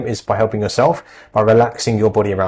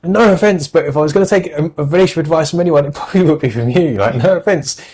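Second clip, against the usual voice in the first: higher, teasing mock put-down; cheeky retold dig at his mum, pitched up; sarcastic mock jab; 6 semitones higher than usual. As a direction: deliver the backhanded dig, pitched up.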